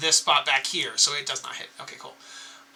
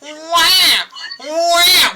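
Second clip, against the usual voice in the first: put-on baby wail; 9 semitones higher, 5 dB louder, much slower than usual. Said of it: "the sound of a whining, crying baby".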